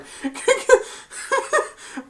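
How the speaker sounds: airy, high real giggle; high breathless giggle. Real, not acted. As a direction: giggle without voice. Laughs at the Grinch line.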